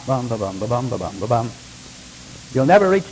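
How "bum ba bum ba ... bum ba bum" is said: low walking rhythm. Retold or imitated. Imitating footsteps on a journey.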